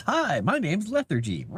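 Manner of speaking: cat's imagined voice. Pretend. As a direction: speak for the cat, sweetly introducing himself. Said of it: "He says".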